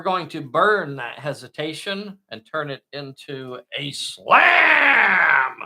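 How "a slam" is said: loud, high drawn-out slam; hyped shout. Acted. Game host announcing an attack, hyped shout. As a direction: belt it out like a ringside announcer.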